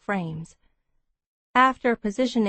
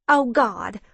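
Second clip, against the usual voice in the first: usual speaking voice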